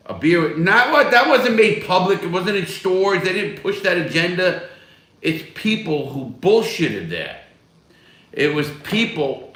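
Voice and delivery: loud, high indignant rant against the critics; heated rant. Real, not acted. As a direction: get worked up defending the beer. Heated defence of Bud Light.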